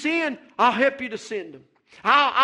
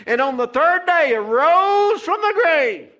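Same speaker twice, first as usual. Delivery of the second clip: high, loud and slow, lifted up; rising proclamation; 6 semitones higher, 6 dB louder than usual. Real, not acted. Preacher to congregation, a loud slow proclamation. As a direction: proclaim it to the rafters.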